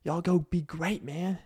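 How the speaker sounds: Southern drawl, y'all go be great; southern accent. Real, not acted. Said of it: "Man, my southern accent stuck out again".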